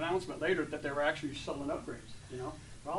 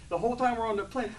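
ordinary delivery

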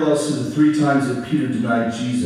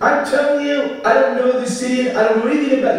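losing it; 10 semitones higher, faster than usual. Acted. Peter losing his temper at the girl, high angry denial. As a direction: snap back in panic at her. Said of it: "And he loses it".

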